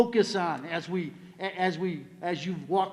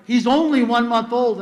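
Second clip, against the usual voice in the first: louder imitated objection; protesting daughter; 3 semitones higher, 8 dB louder than usual. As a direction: retell it as an emphatic, exasperated protest. Retold daughter objecting to the lessons.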